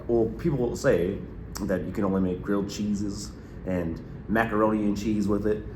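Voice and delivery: ordinary talk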